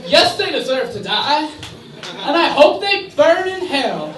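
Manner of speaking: punchline delivery. Shown as a demonstration. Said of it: "so I could say".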